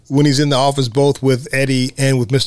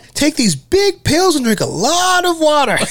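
Roger's voice; 10 semitones higher than usual. Retold or imitated. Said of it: "And Roger's like".

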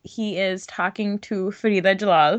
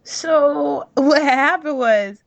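higher, louder, mock-casual confession; mock sheepish hero; 6 semitones higher, 3 dB louder than usual. Son explaining the wedding to his parents, a hammed-up breezy voice. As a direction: ham up a guilty son breezing through bad news.

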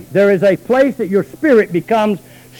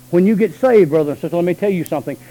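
ordinary talk, nothing marked

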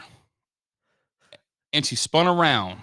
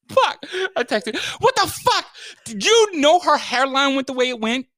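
frantic disbelief; 11 semitones higher than usual. Retold or imitated. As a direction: squeal it out in horror. Retold frantic text, laughing.

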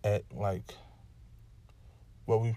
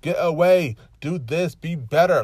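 loud, high exasperated yell at people; fed-up cry of frustration, much higher and louder; annoyed outburst; 8 semitones higher, 15 dB louder, slower than usual. Retold or imitated. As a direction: shout your irritation at the whole world. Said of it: "And so I'm so annoyed".

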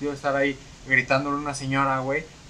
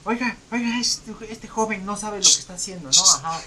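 mocking higher complaining voice; a customer complains about a young employee to the manager; imitating the complaining lady; 6 semitones higher than usual. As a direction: ham up the indignant customer calling the manager. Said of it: "le gritó a mi gerente".

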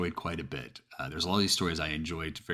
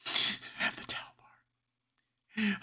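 very high, slow, strangled whisper; strained terrified whisper; much slower than usual. Acted. Caller repeating her weapon to the dispatcher, terrified whisper. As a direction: whisper it as panic takes over.

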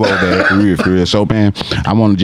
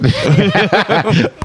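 high burst of laughter; 9 semitones higher than usual. Real, not acted.

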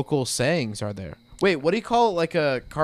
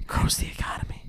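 whisper; 7 dB quieter than usual. Retold or imitated. Mocking repeat whispered.

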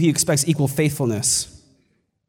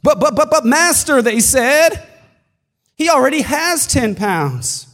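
protesting servants; 9 semitones higher, 4 dB louder than usual. Acted.

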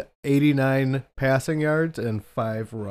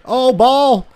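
excited shout; 11 semitones higher, 9 dB louder than usual. Real, not acted. Watching game, fumble happens.

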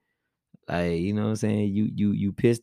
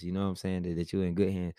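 usual speaking voice

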